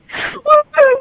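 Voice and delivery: high gleeful ride whoop; excited woohoo. Pretend. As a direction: whoop like on a coaster. Acting out a ride drop.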